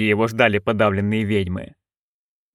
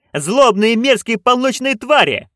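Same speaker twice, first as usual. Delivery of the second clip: much higher, slow shouted chant; 9 semitones higher, 3 dB louder than usual. A narrator's reading. Witches roaring their name at the director, high and slow. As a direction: roar it like a battle cry. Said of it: "witches shout louder".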